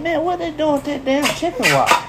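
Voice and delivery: high, mocking old-timer voice; old man voice. Telling a joke to podcast friends, old man on porch voice. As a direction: squeak it out like a nosy old man on his porch. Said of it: "the old man looked at him, he said".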